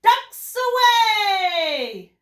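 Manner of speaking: loud, high, drawn-out call; excited exclamation, stretched and high; exclaimed. Shown as a demonstration. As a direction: cry it out big and long, sliding down at the end.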